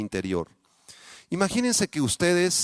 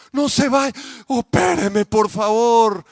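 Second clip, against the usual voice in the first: higher, louder pleading, slowing at the end; patient begging the surgeon to stay, desperate; desperate plea; 7 semitones higher, 6 dB louder, slower than usual. Pretend. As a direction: beg the surgeon not to leave. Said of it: "¿qué le dirías?".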